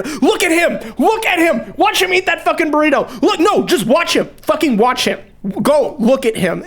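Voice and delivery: high, eager calls of a safari crowd; excited tour guide. Retold or imitated. Safari crowd points out the burrito eater, high and eager. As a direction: point out the rare animal.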